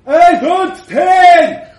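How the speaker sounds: very loud, high, slow drawn-out yell; a drunk young man shouting his demand. Acted. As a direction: bellow it from the doorway. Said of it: "came a shout from the door".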